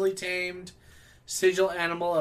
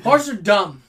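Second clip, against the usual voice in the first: mock angry rant; 3 semitones higher, 11 dB louder than usual. Real, not acted. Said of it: "Why are you so angry about horses".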